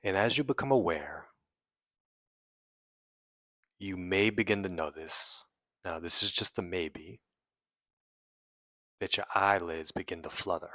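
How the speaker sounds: measured meditation guide with long pauses; guided meditation. Real, not acted. Guiding listeners to release trapped emotions, in an unhurried meditation voice. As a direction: lead them inward phrase by phrase, leaving long silences.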